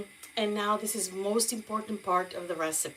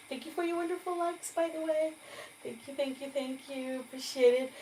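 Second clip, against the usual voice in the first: gushing thanks; 7 semitones higher, faster than usual. Real, not acted. Thanking viewers for likes.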